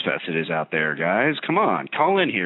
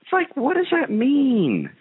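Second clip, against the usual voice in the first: exasperated squeal; slower than usual. Real, not acted. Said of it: "exasperated outburst".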